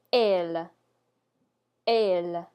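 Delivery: "elle" with the open "eh" of yes; French pronunciation. Shown as a demonstration. Teacher giving the first example for learners, French pronunciation. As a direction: model "elle" clearly, once and then a repeat. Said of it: "first example here is the word".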